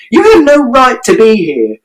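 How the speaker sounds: very high, ringing yell; furious shout. A narrator's reading. Mother shouting at father to leave. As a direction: throw him out with your voice. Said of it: "And Mother shouted".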